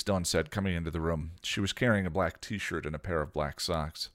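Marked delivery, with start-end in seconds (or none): none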